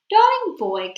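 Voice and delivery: high syrupy cooing witch. A narrator's reading. Witch coos sweetly to the boy. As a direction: coo sweetly like a scheming witch. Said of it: "cooed the Grand High Witch".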